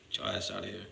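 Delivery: unvoiced, under the breath; muttered dismissal. Real, not acted. Whispered to a chat troll, get out. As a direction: whisper it to shove them off.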